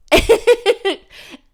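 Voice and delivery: high bright burst of laughter; giddy laugh. Real, not acted. Laughing at her own delusion. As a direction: laugh at your own joke.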